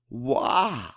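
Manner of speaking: ordinary delivery, nothing marked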